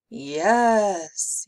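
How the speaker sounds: loud, drawn-out hiss; hissing monster. A narrator's reading. Vladimir answering the babysitter, sinister hiss. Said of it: "he hissed".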